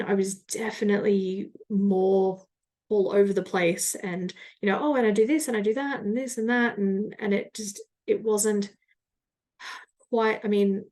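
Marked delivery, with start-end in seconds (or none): 4.8-6.8 fast, scattered rambling; rushed scattered tone. Retold or imitated. Mock-rambling to a coffee chat contact, hurried. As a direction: rattle it off all over the place. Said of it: "this tone of voice of".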